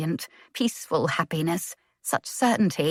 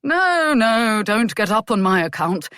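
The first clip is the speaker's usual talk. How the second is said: sarcastic, sharp-tongued father; the Earl mocking the couple who won't get up; acerbic; 5 semitones higher, 5 dB louder than usual. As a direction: bite the words with dry scorn. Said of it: "the Earl said acerbically".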